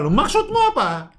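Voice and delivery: "Loh, maksudmu apa?" snapped high with irritation; angry demo. Shown as a demonstration. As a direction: sound annoyed, like you're picking a fight. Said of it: "Jangan memberi pertanyaan dengan marah". (pertanyaan dengan nada marah, tinggi)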